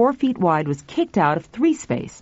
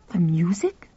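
unvoiced whispered question; 3 dB quieter than usual. Tuffy whispering his guess to Pal. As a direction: breathe it out in shock. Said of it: "Tuffy said".

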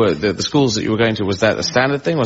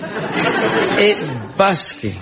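laughing; 7 semitones higher, much slower than usual. Real, not acted.

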